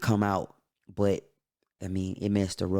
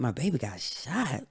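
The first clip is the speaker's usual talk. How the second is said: retold shocked echo, pitched higher; higher, incredulous question on the news; stunned disbelief; 4 semitones higher, 4 dB quieter than usual. Echoing grandma's news back to her, stunned.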